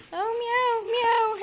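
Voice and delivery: high, loud cat meow; mock meow. Owner meowing back at the cat, high playful meow.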